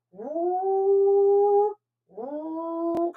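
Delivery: high, slow whooshing woo like a race car. Shown as a demonstration. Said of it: "I sounded like a race car there".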